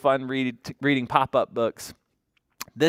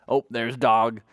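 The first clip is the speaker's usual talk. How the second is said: ordinary talk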